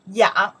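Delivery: yang said loudly as one syllable; model pronunciation. Shown as a demonstration. Teacher pronounces this character for learners, loud yang. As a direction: project yang for the class to copy. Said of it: "This character we pronounce".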